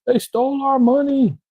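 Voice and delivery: high-pitched mock whining; mock crying. Crypto heads complaining online they got robbed, mock crying. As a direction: whine it out, mocking the complainers. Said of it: "crying online, talking about".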